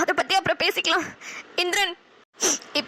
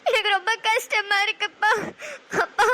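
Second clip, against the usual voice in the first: tearful confession; 3 dB louder than usual. Acted. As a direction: beg his forgiveness through tears. Daughter confesses to father, crying.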